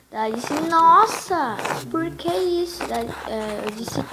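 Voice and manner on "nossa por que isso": high, loud mock dismay; acted shock. Friend reacts to being brushed off, acted shock. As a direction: burst out on the first word, imitating a shocked friend.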